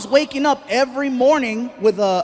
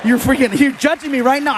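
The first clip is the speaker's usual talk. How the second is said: calling out; 3 semitones higher, 4 dB louder, slower than usual. Real, not acted. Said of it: "calls out judging audience".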